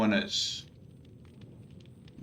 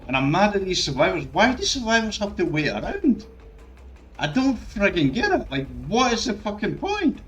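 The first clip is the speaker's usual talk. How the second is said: mad; 6 semitones higher than usual. Real, not acted. Streamer furious at survivors who wait around, angry shout. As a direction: let the anger boil over, pitched way up. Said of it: "I'm so fucking mad".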